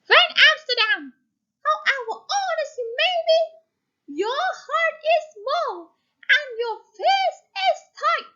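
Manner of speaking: high, fast witchy pronouncement; old woman's curse. Shown as a demonstration. Old woman cursing the stingy baker at the door. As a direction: lay the curse on him like a witch.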